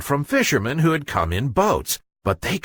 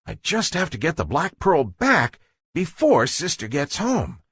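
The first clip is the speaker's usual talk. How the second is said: higher, scheming little fish voice; guppy thinking; 7 semitones higher, faster than usual. Acted. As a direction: talk yourself into it like a guilty kid. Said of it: "he thought".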